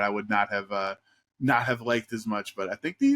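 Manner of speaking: usual speaking voice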